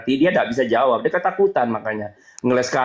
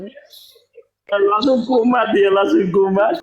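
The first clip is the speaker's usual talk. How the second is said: mocking sing-song; 7 semitones higher, slower than usual. Real, not acted. Mocking host's outburst.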